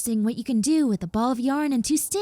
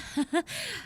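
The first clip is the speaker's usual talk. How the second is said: soft laugh; 8 semitones higher, 5 dB quieter than usual. Acted. Laughs softly at herself.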